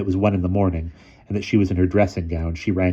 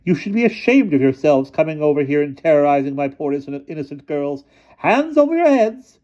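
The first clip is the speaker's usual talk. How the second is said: shrill headmistress; 9 semitones higher, 6 dB louder than usual. A narrator's reading. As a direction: play the fussy headmistress, pitched way up. Miss Scrimmage scolding.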